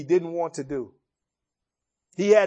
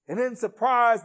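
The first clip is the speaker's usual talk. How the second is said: ordinary talk, nothing marked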